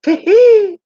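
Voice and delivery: very high, loud squealing tee-hee; a squeaky comic giggle in a put-on voice; shrill mocking titter, high and loud; Damon Wayans giggle. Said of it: "I'm gonna do a Damon Wayans".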